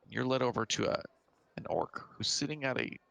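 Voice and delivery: ordinary talk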